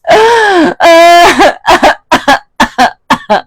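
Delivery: loud high laugh trailing off a fake sob; loud laugh breaking out of a mock cry; burst of laughter. Real, not acted. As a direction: laugh out loud at your own act. Laughs after mock wail.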